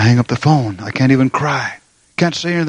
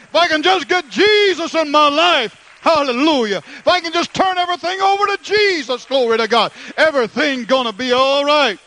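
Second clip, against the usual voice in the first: high-pitched fervent sermon, building higher; impassioned preaching; 11 semitones higher than usual. Acted. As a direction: preach it with mounting fervor, pitched way up. Preaching builds to full force.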